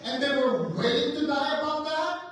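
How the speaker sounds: ringing, insistent sermon delivery; preaching at full force. Real, not acted. Preacher builds to climax.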